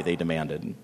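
ordinary delivery, nothing marked

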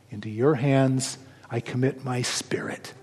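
soft, low, voiceless whisper of dying words; a whispered last prayer to the Father; hushed whisper. Acted. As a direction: breathe it out as a final surrender. Jesus's last words, quoted.

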